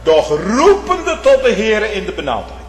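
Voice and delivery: loud, high fervent preaching; impassioned sermon climax; preaching at full force. Acted. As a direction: thunder it from the pulpit.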